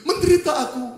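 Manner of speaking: hammed-up self-pity; self-pitying sufferer. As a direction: complain as if greatly afflicted. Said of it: "lalu kita berkata".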